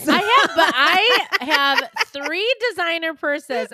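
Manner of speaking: laughing through a sheepish confession; slow, loud giggly admission. Real, not acted. Admitting purses while laughing.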